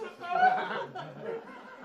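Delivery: high cackling laugh; loud burst of laughter. Real, not acted. Performer cracking up at own line. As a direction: break into a big laugh.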